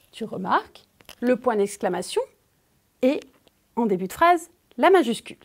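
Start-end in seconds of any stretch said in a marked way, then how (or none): none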